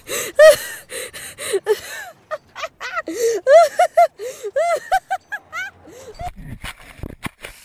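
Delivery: long high laughing fit; big laugh. Real, not acted. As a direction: crack up laughing. Friend cracks up after win.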